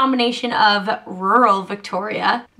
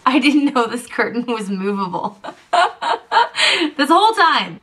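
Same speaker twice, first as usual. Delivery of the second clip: laughing while talking; 2 semitones higher than usual. Real, not acted. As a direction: laugh through the words. Laughing at discovery.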